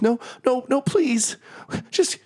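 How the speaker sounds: tiny elephant watching her angel turn to fire, begging; Lulu begging in panic. Acted. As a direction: beg her friend to stop, climbing in panic. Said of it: "and Lulu says".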